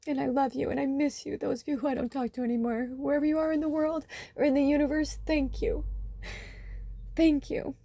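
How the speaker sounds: high, soft, choked-up thanks; emotional. Real, not acted. Reader thanks lost loved ones, getting really emotional. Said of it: "I'm getting really emotional".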